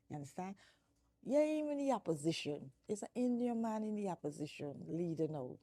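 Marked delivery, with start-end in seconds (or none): 1.2-5.6 heated rant. Real, not acted. Ranting at political party.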